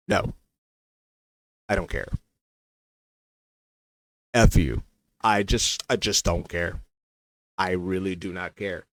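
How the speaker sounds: low, quiet, weary refusal; sulky indifference. Retold or imitated. Recalling his refusal to share the post, sulky and low. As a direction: drop it low and soft, past caring. Acted sulking refusal to join in.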